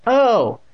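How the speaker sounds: high, loud acted yelp; sudden high "oh" of hurt; cry of pain. A narrator's reading. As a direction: yelp as if hit. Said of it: "Andy uttered a low cry".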